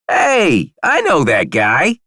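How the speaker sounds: very high, loud squeaky exclamation; excited small character. A narrator's reading. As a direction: pipe up high and loud, drawing out the words. Buddy pipes up from a lap.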